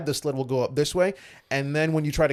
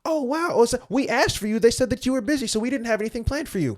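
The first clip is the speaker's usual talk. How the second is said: high, sing-song fake apology; imitating a flustered interviewer making excuses; mock awkward excuse; 9 semitones higher than usual. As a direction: brush him off sweetly, much higher. Mocking awkward media excuse.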